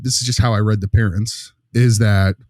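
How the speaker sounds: normal talking voice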